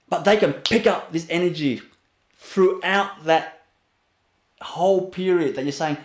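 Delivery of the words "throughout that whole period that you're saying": normal talking voice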